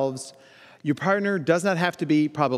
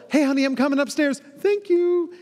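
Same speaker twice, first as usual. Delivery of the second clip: much higher, sing-song announcement to honey; sweet check-in to wife; 10 semitones higher, 3 dB louder than usual. Retold or imitated. Husband sweetly reporting in to his wife, high and light. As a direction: chirp it up high like a cheerful husband.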